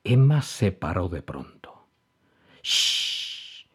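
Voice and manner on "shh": whispered shh; shushing. A narrator's reading. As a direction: hush your friend softly. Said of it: "dijo Emma con el dedo en la boca".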